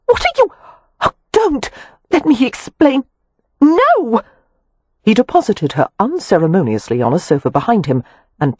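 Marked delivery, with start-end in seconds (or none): 0.1-4.2 high, rising panicked pleas; alarmed protest. A narrator's reading. Wynne pleading with Kev as he grabs her, high alarmed cry. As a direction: cry out, pleading him to stop.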